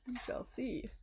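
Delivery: put-on a secretive whisper to the kids; teasing whisper. Reader teasing the children before the story, whispered. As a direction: hush it like a secret.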